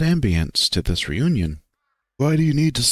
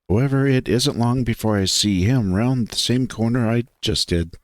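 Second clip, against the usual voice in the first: plain reading voice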